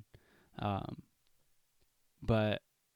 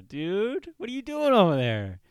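high, drawn-out exasperated complaint; exasperated Native slang; 8 semitones higher, 3 dB louder than usual. Acted. Guy griping at a friend, mock exasperated. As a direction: call them out in mock exasperation. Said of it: "natives like to say".

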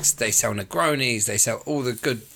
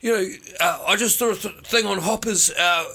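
much higher, brighter blokey mate pitching a scheme; imitating Josh; 6 semitones higher than usual. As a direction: do your mate hyping up free kegs, high and chatty. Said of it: "He's like".

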